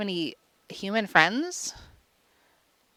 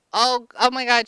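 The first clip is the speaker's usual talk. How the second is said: ordinary talk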